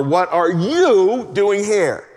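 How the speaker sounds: slow, loud, high with "you" leaned on hard; stress on you. Shown as a demonstration. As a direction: drag it out, pressing the word "you". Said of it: "you could stress any word".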